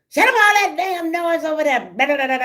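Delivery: high, biting mock shout; mock yell. Neighbor yelling at daddy on the porch, mock shout. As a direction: snap at the noise from the porch. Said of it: "she was there".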